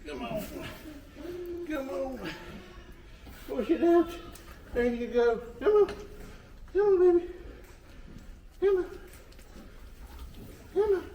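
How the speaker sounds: high, urgent coaxing calls. Real, not acted. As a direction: urge her on to push. Urging ewe to push.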